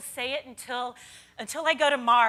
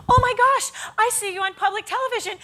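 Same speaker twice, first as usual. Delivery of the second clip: put-on high, gushing young fan, much higher; excited fan; 10 semitones higher, 7 dB louder than usual. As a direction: squeal like a starstruck fan spotting a TV host. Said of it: "a young lady came up and she goes".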